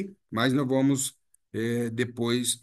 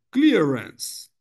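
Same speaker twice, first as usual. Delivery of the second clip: normal talking voice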